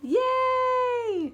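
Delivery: high bright yay; excited cheer. Acted. Mom cheering for Puddle's mud season. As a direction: cheer it out, pitch jumping up.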